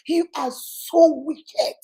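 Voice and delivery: slow, stretched-out accusation; hammed-up, drawn-out indignation; acted reproach. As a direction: drag out the reproach melodramatically. Acts wife berating her husband.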